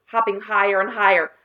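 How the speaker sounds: plain reading voice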